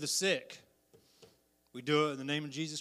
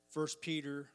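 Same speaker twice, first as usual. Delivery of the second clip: usual speaking voice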